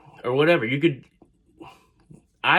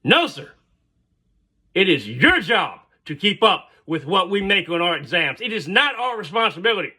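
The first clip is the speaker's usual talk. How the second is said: defiant student; 6 semitones higher than usual. Retold or imitated. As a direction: push back hard at the professor, pitched up. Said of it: "And then the girl goes".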